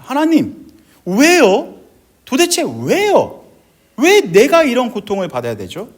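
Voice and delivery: high, loud anguished plea to God; disappointed protest. Retold or imitated. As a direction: re-enact crying out "why" in frustration. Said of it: "저도 하나님께 실망했었던 적이 있습니다". (실망해서 크게 따지는 절규)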